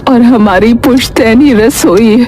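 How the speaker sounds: put-on old grandmother, weak but urgent; lightly voiced dying grandmother. Grandmother reveals family recipe.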